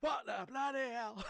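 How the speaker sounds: a high, slow, shocked cry; startled passerby. Retold or imitated. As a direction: play a passerby jolted by sudden gunfire. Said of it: "the person outside going".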